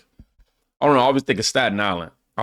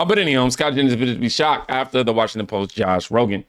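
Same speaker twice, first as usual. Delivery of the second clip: ordinary talk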